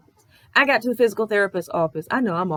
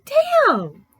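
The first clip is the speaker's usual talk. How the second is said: stunned; 6 dB louder than usual. Retold or imitated. Stunned by rude questions.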